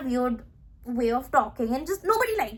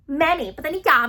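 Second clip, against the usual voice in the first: ordinary talk, nothing marked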